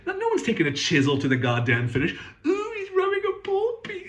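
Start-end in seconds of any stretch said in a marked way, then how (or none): 2.5-4.1 much higher, prissy mock gasp; squeaky mock alarm at a pole piece; mocking a fussy commenter. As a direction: play the horrified guitar snob, high-pitched.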